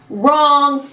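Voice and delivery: loud, high shout of wrong. Shown as a demonstration. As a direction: bark it like a game-show buzzer. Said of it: "but you're wrong".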